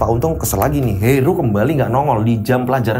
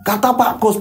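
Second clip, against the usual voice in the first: usual speaking voice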